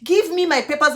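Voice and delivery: high, loud demand; shouting demand. Retold or imitated. Demanding her papers from the officer, shouting. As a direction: shout it over him. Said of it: "I was trying to shout".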